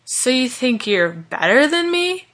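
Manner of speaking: slow, slurry challenge rising sharply at the end; drunken, offended question climbing high; angry drunk challenge. A narrator's reading. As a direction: pick a fight, dragging the words. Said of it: "asked Vaughn angrily as he swayed".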